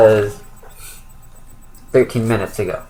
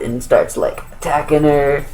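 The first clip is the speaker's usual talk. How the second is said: ordinary talk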